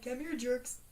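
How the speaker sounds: imitating Crystal calling to jerks; mock flirty call. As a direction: beckon them in a teasing call. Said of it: "She's just like".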